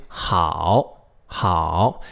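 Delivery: 好 dipping low, then rising; rises at the end. Shown as a demonstration. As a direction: let 好 hook upward at the end. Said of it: "see how it rises at the end".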